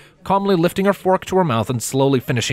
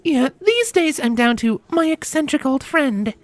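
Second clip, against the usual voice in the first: much higher, grinning woman's voice; grinning Christy. A narrator's reading. Christy teases her ex-husband across the table, grinning. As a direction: tease him with a sly grin. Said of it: "with a Cheshire grin".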